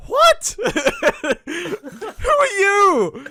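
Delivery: loud, shrieked disbelief, very high; laughing, drawn-out mock shock at a stranger; incredulous. Retold disbelief at changed voice.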